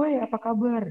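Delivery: high, bright call of Oi across to a friend; friendly greeting. Acted. Greeting a friend met in passing, mock cheerful call. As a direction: hail a friend you just passed. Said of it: "Nah, komunikasi misalnya".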